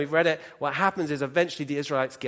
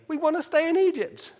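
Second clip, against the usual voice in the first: mock whine; 10 semitones higher than usual. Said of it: "no account of the Israelites saying".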